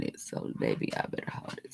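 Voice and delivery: soft concentrated whisper. Real, not acted. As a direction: whisper while focusing. Concentrating on lash glue.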